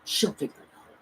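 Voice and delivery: soft whispered man's voice; whispered calm man; whispered reassurance. Retold or imitated. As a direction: hush it like a secret. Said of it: "he was like".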